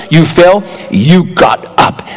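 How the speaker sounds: ordinary talk, nothing marked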